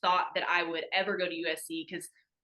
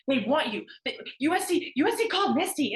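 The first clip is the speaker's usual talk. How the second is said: much higher and louder breathless rush of news; excited mom; 8 semitones higher, 6 dB louder than usual. Retold or imitated. Mom telling daughter USC wants her, higher and louder excitement. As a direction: burst out with the big news like an overjoyed mother.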